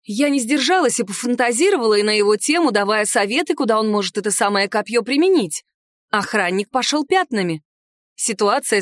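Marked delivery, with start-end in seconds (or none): none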